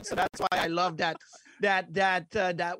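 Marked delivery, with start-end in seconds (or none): none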